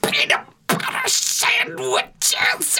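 very high, slow, breathy whisper-shout; a frantic, wheezy poet reading aloud; Shel Silverstein. Retold or imitated. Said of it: "he sounds just like this".

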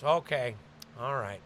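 cartoonish dad voice, calm then rising; dad like Kermit the Frog, turning curt; lightly voiced dad, polite threat; dad impression. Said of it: "where I do impressions of my dad".

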